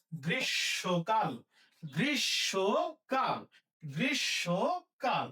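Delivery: গ্রীষ্মকাল drawn out as grish-sho-kal; slow model pronunciation. Shown as a demonstration. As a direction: separate each syllable of গ্রীষ্মকাল, m silent. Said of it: "তাহলে হবে কি".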